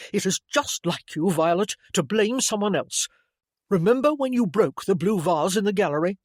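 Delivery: loud, heated sibling accusation; angry scolding. A narrator's reading. Brother to lying sister, heated scolding. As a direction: reel off the old grievance sharply.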